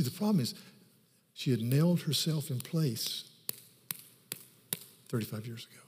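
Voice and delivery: ordinary talk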